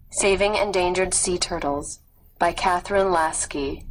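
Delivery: ordinary talk, nothing marked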